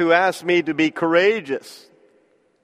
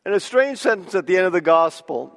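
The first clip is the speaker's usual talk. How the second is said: usual speaking voice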